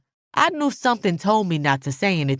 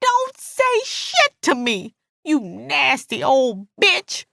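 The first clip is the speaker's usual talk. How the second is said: high, slow, furious insult; drawn-out enraged outburst; thundering anger; 8 semitones higher, slower than usual. A narrator's reading. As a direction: spit out each word slowly at high pitch. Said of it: "she thundered".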